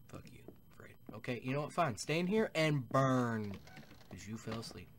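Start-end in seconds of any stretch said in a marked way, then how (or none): 0.1-0.9 hissed under the breath; whispered curse. Real, not acted. Player cursing a sleeping NPC in a whisper. 2.0-3.6 slow mock threat. Leaving Fred to die.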